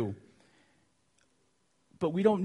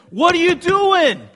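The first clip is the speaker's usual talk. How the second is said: full-voiced angry yell, pitched way up; captain yelling; 10 semitones higher, 9 dB louder than usual. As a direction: holler over a storm at a sleeper. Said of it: "he yells at him".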